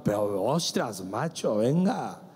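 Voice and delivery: imitating a Spanish friend's Castilian Spanish accent; Spain Spanish accent. Businessman friend pleading with the pastor, in a mock Castilian Spanish accent. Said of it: "Y él se agarraba".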